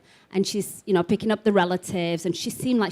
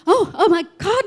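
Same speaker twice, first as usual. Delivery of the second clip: overwhelmed; 10 semitones higher, 3 dB louder than usual. Retold or imitated. Retells being overwhelmed by voices.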